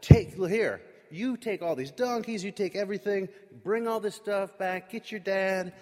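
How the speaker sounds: high, emphatic string of orders; eager commanding. Retold or imitated. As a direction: order briskly with pitch lifted. Pharaoh gives eager generous orders.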